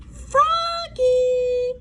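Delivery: high calling voice. A narrator's reading. Mother calling Froggy awake in the morning, calling out. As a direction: call him from across the house. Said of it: "called his mother the next morning".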